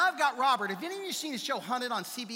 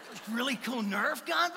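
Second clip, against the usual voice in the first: ordinary delivery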